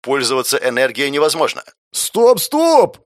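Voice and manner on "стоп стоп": high, loud panicked yell. Acted. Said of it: "завопил я".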